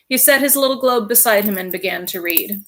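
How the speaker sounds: plain reading voice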